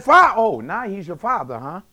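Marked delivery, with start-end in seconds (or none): 0.0-0.3 high, loud cry cut short. Acted. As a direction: start a loud cry, breaking off mid-word. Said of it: "He cried out and said".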